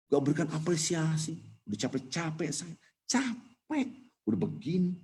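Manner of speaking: high-pitched, put-on whine of grievance; mock aggrieved complaint. Preacher mimics someone unthanked for good deeds, high aggrieved complaint. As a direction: complain high and soft, acting worn out and unappreciated. Acting an unappreciated do-gooder complaining.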